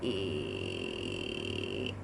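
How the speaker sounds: deep creaky rattling hum; vocal fry. Shown as a demonstration. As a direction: let the voice crackle lazily on a low hum. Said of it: "we can do that again together".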